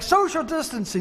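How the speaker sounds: high-pitched imitation of scolding; mock screaming. Angry customer scolding over distancing, mock screaming. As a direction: screech it as a mocking imitation. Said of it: "this man started screaming at me".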